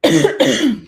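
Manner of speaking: clears throat before going on; a quick, loud throat clear. Real, not acted. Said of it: "excuse me".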